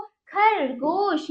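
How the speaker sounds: खरगोश drawn out very slowly, syllable by syllable; slow teacher demo of खरगोश; slow model word. Shown as a demonstration. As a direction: stretch खरगोश so kids hear every sound. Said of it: "आगे बच्चों".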